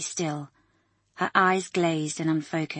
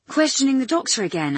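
sarcastic; 7 semitones higher, 6 dB louder than usual. Acted. Doctor scolds nurse.

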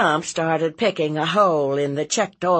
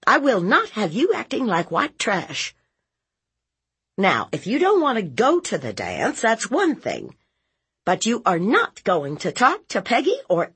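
high, emphatic lecture; stern scolding aunt; 7 semitones higher, 3 dB louder than usual. Acted. Aunt forbidding nephew to talk so, stern and high. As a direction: lay down the law firmly. Narrator voices aunt laying down law.

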